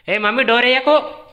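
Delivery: loud, high call across to someone. Real, not acted. Speaker telling mummy not to shut the door, calling out. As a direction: raise pitch and volume to stop them.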